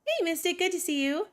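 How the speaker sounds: usual speaking voice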